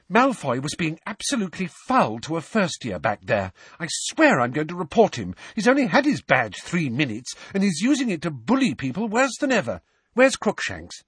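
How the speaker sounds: indignant Hermione. A narrator's reading. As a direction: complain hotly about Malfoy.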